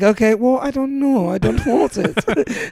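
high, drawn-out mock British accent. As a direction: put on a high-pitched British child's refusal. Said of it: "it'd be hard for me to be like".

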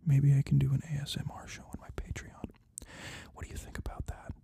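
unvoiced close-mic ASMR whisper. Shown as a demonstration. Podcaster demoing ASMR for his Patreon, whispering. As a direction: whisper right into the mic, teasing. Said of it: "Let me turn my mic".

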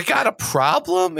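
high, slow parrot voice; Iago voice. Shown as a demonstration. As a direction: demonstrate Iago's line. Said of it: "You gotta say".

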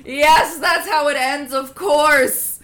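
loud, high, laughing outburst; excited. Real, not acted. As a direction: cheer with dark glee. Mock-cheering the grim ending.

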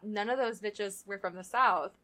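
ordinary delivery

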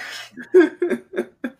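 high giggle; helpless high laugh. Real, not acted. Laughing at his own hiccups.

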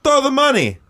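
very high, loud demand; mock holler up to a balcony; imitated calling up. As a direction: call it out across a distance.

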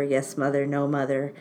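ordinary delivery

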